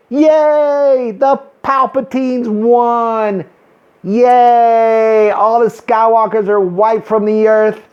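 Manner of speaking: hollow, over-bright hurrah; sarcastic cheering. Pretend. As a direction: cheer with fake delight, drawing out each yay. Sarcastic cheering over a hated ending.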